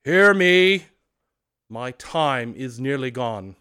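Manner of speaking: loud, commanding ghost call; unearthly voice. Acted. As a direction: summon attention like a spirit.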